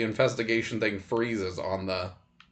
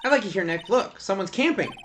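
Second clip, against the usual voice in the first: higher, eager sidekick voice; lightly voiced girlish assistant; 8 semitones higher than usual. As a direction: lightly play the excited young assistant.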